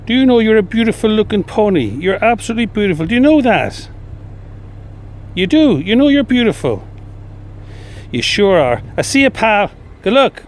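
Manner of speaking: high, sing-song pet talk to a pony. Real, not acted. Cooing to a beautiful pony.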